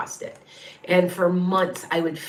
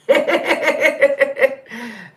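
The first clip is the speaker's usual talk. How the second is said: a real, higher laugh; amused laugh; 7 semitones higher, 6 dB louder than usual. Real, not acted. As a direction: let a laugh burst out at the joke. Laughs at the cow cat.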